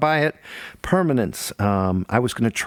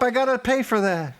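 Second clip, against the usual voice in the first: high, frantic pleading; panicky, rushing to stop it; panicked; 13 semitones higher than usual. Real, not acted. Panicked at assistant playing music.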